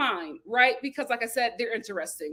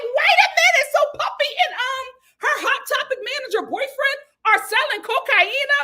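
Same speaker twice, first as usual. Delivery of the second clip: much higher, loud disbelieving cry; shocked; 13 semitones higher, 5 dB louder than usual. Retold or imitated. Host reacting to the drug reveal, shocked high cry. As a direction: shriek it like you can't believe it.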